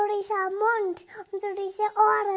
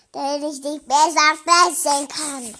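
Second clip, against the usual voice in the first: big bad wolf; 4 semitones lower, 9 dB louder than usual. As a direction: lower and much louder wolf roar. Child plays the wolf.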